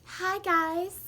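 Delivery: overexcited influencer welcoming viewers; YouTuber voice. Pretend. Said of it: "I just had to make fun of a YouTuber voice".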